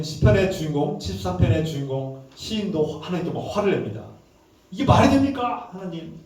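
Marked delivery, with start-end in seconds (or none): 4.7-6.2 acted indignant protest, opening high; mildly angry challenge, pitched up; indignant complaint. Psalmist protesting to God about the wicked prospering, angry retold complaint. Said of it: "막 화가 나요".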